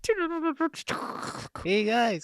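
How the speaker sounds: very high, cartoonish hey; squeaky mock hello to the audience; squeaky silly greeting. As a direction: chirp it like a cartoon character.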